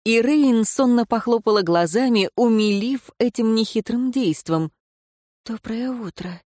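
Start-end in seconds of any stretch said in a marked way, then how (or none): 5.5-6.4 a soft, slightly hoarse morning hello, much softer; just woken, voice a little rough. A narrator's reading. Leira, just woken, greets the advisor slightly hoarsely. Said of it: "чуть хрипловато произнесла".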